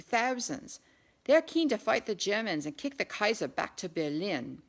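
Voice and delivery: earnest constable explaining the crowd; lightly voiced bobby. A narrator's reading. British bobby telling Claire about the recruits, lightly voiced.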